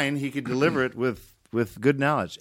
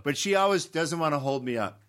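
ordinary talk, nothing marked

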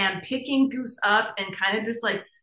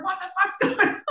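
higher, mock panicked voice; husband freaking out; 10 semitones higher, faster than usual. Husband Cam reacting to the dog, mock panicked. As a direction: imitate him losing it. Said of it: "He's freaking out".

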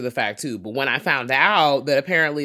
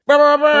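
loud high imitated alarm ring; alarm sound; 14 semitones higher, 14 dB louder than usual. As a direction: ring it out like a warning bell. Sounds a spoiler alert alarm.

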